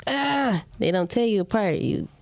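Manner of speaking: ordinary talk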